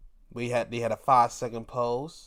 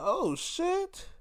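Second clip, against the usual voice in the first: shocked oh shit; 3 dB quieter than usual. Retold or imitated. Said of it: "And I'm like".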